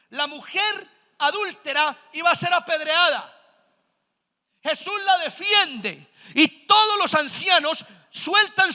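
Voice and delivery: full-force pulpit storytelling; fiery preaching. Acted. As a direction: preach the scene out to the crowd.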